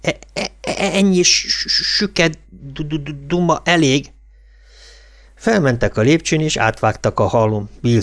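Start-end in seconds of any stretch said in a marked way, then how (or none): 0.0-4.1 put-on stammering boy, higher-pitched and halting; impatient stutterer. A narrator's reading. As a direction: force the words out through a stutter. Stuttering boy cuts off the chatter.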